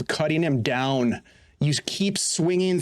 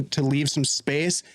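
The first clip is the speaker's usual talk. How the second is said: normal talking voice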